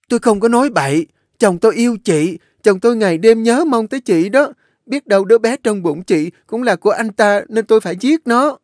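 hysterical accusation. A narrator's reading. An Nhã screams accusations at Nụ, hysterical. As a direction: throw out every accusation.

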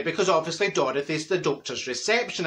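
ordinary talk, nothing marked

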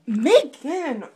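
loud exasperated yell; yelling a name. Real, not acted. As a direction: call her out sharply.

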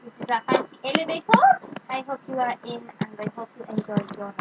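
soft fast Italian host; hammy Italian lift attendant; Italian accent. Pretend. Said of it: "Now I've gone Italian".

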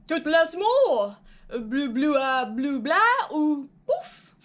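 slow, loud, drawn-out nonsense French; fake French gibberish. Joey doubling down on fake French, slow and loud. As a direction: ham up the pretend French, stretching every sound. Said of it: "Joey fakes French again".

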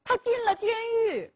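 put-on high, tearful voice of a weeping girl; crying words. Daughter tells her parents the news, weeping high. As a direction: let the bad news break out through tears. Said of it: "边哭边说".

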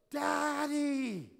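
put-on high, loud, drawn-out child's call; imitated toddler yell. Toddler yelling for his dad down the aisle, imitated loud call. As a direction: holler like a toddler wanting Dad. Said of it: "yelling at the top of his lungs".

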